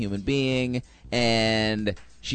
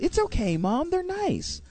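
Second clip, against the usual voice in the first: put-on breezy teen. Teen brushing off her mother.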